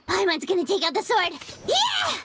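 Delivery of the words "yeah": much higher battle cry; psyched up to fight; excited. Acted. Brandishing the sword.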